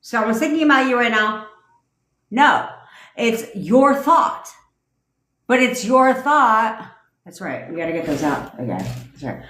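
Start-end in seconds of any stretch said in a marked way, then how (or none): 0.0-1.5 high, chirpy pretend announcement; hammed-up notification voice; mock inner alarm voice. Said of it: "There's no flag that goes up that's like". 3.1-6.9 "your" drawn out slow and loud; slow emphatic "your thought"; stress on your. Shown as a demonstration. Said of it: "insists on your".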